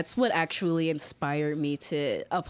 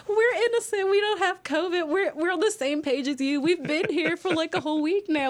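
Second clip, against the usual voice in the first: much higher, hammed-up protesting innocence; mock pleading; 11 semitones higher than usual. Students protesting to locals they have no covid, high mock pleading. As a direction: plead your case, half laughing.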